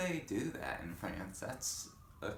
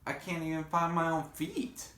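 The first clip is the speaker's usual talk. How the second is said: worried Pip; 9 semitones higher than usual. Acted. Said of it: "said Pip".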